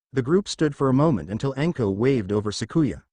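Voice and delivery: ordinary delivery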